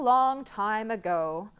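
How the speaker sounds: ordinary delivery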